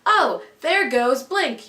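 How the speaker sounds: imitated yell. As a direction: call out teasingly like the friend. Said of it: "my friend kinda just yells".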